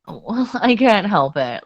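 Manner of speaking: normal talking voice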